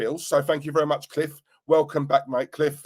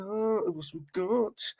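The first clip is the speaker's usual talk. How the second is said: slow, higher, drawn-out sing-song; 5 semitones higher, 6 dB quieter, much slower than usual. Shown as a demonstration. Streamer musing to chat who else is there, slow sing-song. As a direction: stretch it out lazily, teasing the chat.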